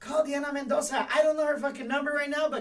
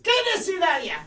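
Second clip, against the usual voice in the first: calling out; 5 semitones higher, 5 dB louder than usual. Acted. Host calls out loudly.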